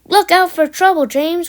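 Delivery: high, loud call of caution; warning. A narrator's reading. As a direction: alert the engine urgently. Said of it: "warned his driver".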